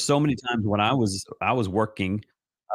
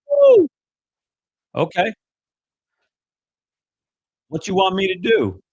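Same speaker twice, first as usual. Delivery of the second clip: high, yelped woo then higher surprised question; startled excitement; 10 semitones higher, 6 dB louder than usual. Retold or imitated. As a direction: caught off guard, jumping at the call. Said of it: "I'm like".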